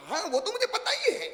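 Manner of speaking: high laughing mock know-it-all; know-it-all bride. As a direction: toss it off high and smug, laughing. (हँसती हुई ऊँची आवाज़ में शेखी)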